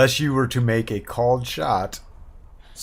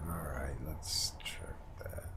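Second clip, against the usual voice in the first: whisper; 9 semitones lower, 16 dB quieter than usual. Real, not acted. Whispered aside while checking.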